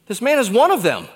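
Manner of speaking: put-on loud accusing servant girl. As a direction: call him out in front of others.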